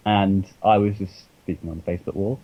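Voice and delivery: normal talking voice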